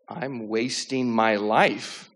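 very slow, drawn-out retold realization; dawning realization. Retelling the thought that hit him on the couch, slowly dawning. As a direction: stretch each word as it sinks in.

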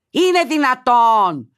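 high, loud, drawn-out disbelief; loud slow exclamation of disbelief; incredulous cry. Real, not acted. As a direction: stretch it out, utterly aghast. Host aghast at the letter.

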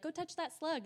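high, playful mock urging of a kid; parent egging on child.

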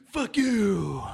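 loud, high outburst. Acted. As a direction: blurt it out loud. 2020 went wrong.

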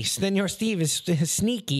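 lightly accented high imitation; a hint of the coworker's broken English; mock accented line. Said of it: "like he was doing it sneaky".

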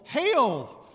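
loud, high, ringing salute; formal hail. Retold or imitated. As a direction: herald a superior. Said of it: "salutes Mary with this formal".

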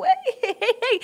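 high-pitched playful fending off; high protesting hey. Acted. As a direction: push him off playfully. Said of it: "and go".

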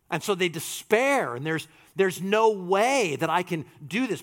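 ordinary talk, nothing marked

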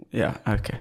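ordinary delivery

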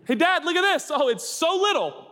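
put-on high, excited child; little kid voice. Child showing his dad a stone, high and excited. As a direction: squeal like a small boy at the water.

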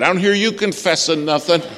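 high, loud imitation of yelling; retold yell. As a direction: call out a retold rebuke, pitched high. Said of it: "he yelled at me".

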